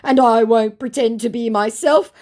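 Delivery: high, loud overdone protest; mock his earnest pleading; mock-earnest impostor. Impostor wanting to know her better, mock earnest.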